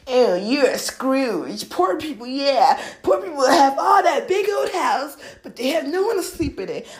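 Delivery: high, loud, stretched-out mimicry; exaggerated indignant mockery; mocking righteous scolding. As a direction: scold the rich like a righteous critic. Mimics poor-but-happy argument.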